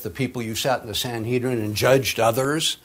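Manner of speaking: ordinary talk, nothing marked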